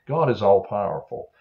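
ordinary delivery, nothing marked

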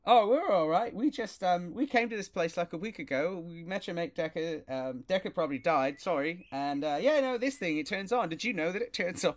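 high, chatty dog voice; high-pitched canine character, excitable and casual; friendly mutt. Acted. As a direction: ramble eagerly as a talking dog.

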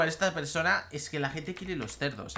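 ordinary talk, nothing marked

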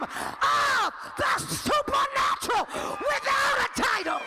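rising to high, shouted preaching; preaching building to climax. Real, not acted. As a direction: drive upward into long, stretched cries.

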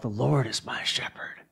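soft unvoiced whisper of the psalm line; whispered prayer. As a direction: whisper it as the lonely sheep's plea. The sheep who cries out.

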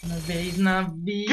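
slow, stretched-out repeat; drawn-out insistence. Real, not acted. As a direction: drag each word out to drown out objections. Repeating his excuse over the other.

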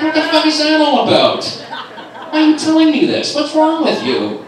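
high, loud outraged yell; exasperated shout. Acted. Friend yelling at his oversharing buddy, outraged shout. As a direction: blow up at him, pitched way high.

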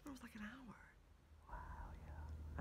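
hushed whisper. Real, not acted. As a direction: drop to a stoned whisper. Hushed aside after outburst.

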